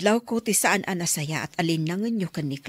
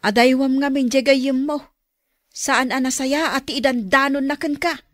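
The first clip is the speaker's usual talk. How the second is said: scolding; 4 semitones higher, 7 dB louder than usual. Acted. Mother scolding about friend.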